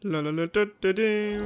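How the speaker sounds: ordinary talk, nothing marked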